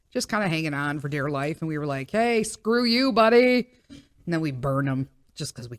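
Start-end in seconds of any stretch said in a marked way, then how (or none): 2.1-3.7 drawn-out, raised mock taunt; higher, sing-out mock dismissal; mock telling-off. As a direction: playfully tell off a pest, stretching the words. Acting out telling off a tick.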